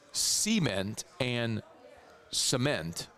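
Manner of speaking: cement said first with stress on the first syllable SEE-ment, then on the second se-MENT; slow demo of cement, SEE-ment then se-MENT; cement two ways. Shown as a demonstration. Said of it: "the emphasis on different syllables there".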